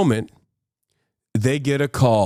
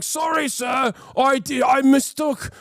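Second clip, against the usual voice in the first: much higher, flustered apology; higher panicky guard apologizing; groveling guard; 11 semitones higher than usual. Retold or imitated. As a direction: cringe and backpedal to a superior. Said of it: "the guards will be like".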